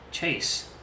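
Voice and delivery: breathless whispered echo; whispered name. A narrator's reading. Chase echoes his own name in a whisper.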